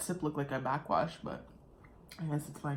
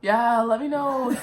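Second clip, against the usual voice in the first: laughing; 6 semitones higher, 6 dB louder, slower than usual. Real, not acted. Laughing at own burp.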